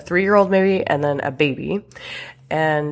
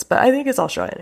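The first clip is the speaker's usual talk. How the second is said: much higher, questioning repeat; unsure; 8 semitones higher, faster than usual. Real, not acted. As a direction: lift it high as if unsure of yourself.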